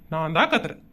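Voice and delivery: very low, soft admission; meek backing down. Acted. Husband giving in to angry wife, meekly quiet. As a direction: take the blame under your voice. Husband backs down, takes the blame.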